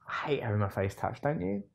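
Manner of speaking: ordinary talk, nothing marked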